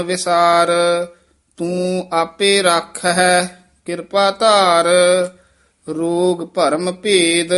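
measured, sustained Gurbani recitation; scripture recitation. Shown as a demonstration. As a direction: recite phrase by phrase, holding the endings.

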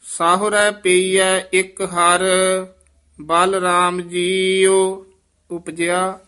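measured, ringing scripture chant; Gurbani recitation. Shown as a demonstration. Teacher recites the verse for the student, chanting. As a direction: intone the verse slowly and fully.

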